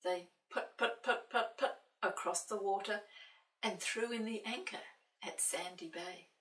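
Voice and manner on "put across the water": plain reading voice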